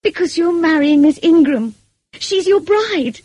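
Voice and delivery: high, loud, anguished protest; indignant accusation. A narrator's reading. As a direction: hurl it at him, stretching the last phrase. Acted quarrel, Jane accusing Rochester.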